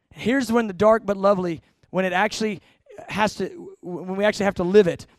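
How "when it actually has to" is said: normal talking voice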